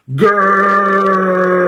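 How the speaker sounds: long high roaring grrr; loud growl. Acted. Boris roars his loudest grr at the contest. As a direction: let out a big contest roar.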